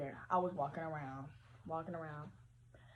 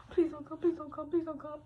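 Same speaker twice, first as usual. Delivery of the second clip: higher, pleading scary-movie victim; scared horror movie girl; 7 semitones higher than usual. Pretend. Hiding from a killer in a scary movie, pleading high. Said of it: "them awful scary movies when she got like".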